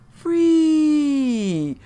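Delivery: long high loud cry of Free; ecstatic cry. Acted. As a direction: let it ring out as you leap. Mother leaping from ledge.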